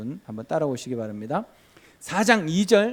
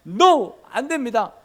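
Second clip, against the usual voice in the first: Moses' loud refusal; 7 semitones higher, 9 dB louder, slower than usual. Acted.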